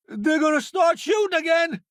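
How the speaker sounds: high, urgent warning; alarmed shout. Acted. Warning in combat.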